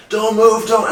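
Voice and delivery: loud, high imitation of panicked staff; frantic shouted order. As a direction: shout over the struggling patient. Retold staff ordering during laser treatment.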